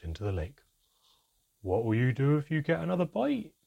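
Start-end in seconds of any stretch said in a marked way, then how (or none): 1.6-3.5 smiling. A narrator's reading. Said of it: "he asked, smiling".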